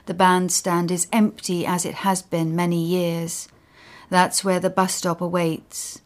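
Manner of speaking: plain reading voice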